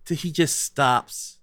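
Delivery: usual speaking voice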